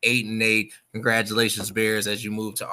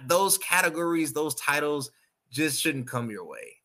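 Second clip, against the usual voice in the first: ordinary delivery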